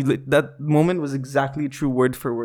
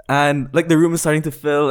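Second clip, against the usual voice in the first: usual speaking voice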